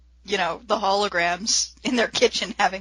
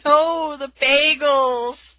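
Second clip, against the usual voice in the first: raised, slow wail over the bagels; mock dismay; 6 semitones higher, 4 dB louder, much slower than usual. [name] mock-wailing as the craft table flips. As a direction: play it as mock tragedy. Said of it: "[name] going".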